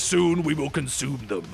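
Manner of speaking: low, ominous shark voice; deep-pitched mock menace; Bruce, menacing shark. Bruce the mutated shark speaks.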